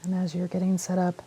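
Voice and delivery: ordinary talk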